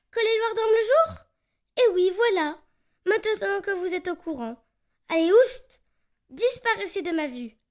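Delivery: chasser l'intrus, fort; très énervé. Acted. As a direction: ordre furieux, très fort. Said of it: "décidément très énervé". (loir en colère, criant)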